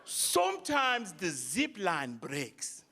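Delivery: low, slow, deep-voiced African guide; slow low African accent, unbothered; African guide impression. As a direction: drop the voice low and drag it out like the calm guide. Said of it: "He goes".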